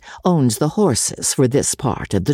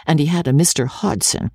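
ordinary delivery

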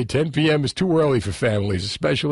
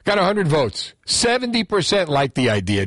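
ordinary talk

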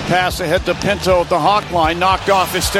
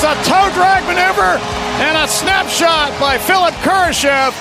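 ordinary talk

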